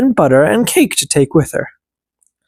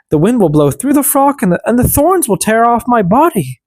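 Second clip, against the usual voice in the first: put-on higher, worried young girl protesting; higher pleading girl; 7 semitones higher than usual. A narrator's reading. Girl protesting to her stepmother, higher and worried.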